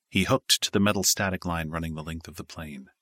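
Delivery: ordinary delivery, nothing marked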